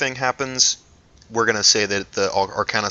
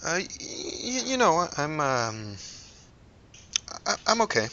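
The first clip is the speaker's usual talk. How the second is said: faltering voice; 8 semitones higher, 6 dB quieter than usual. Acted. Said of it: "her voice faltered".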